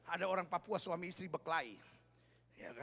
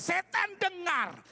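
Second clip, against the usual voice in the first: loud, high commanding shout; acted deliverance-preacher shout; exorcist command; 9 semitones higher, 8 dB louder, much slower than usual. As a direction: shout it at the devil, drawn out. Acts out exorcist commanding Satan.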